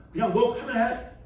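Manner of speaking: mock imperious command; bossy master voice. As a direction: order it like an absolute king. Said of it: "끝이어야 되는데".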